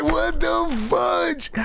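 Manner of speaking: high, drawn-out disbelief; mock outrage. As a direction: stretch it out high in hammed-up shock. Shocked reaction to being asked.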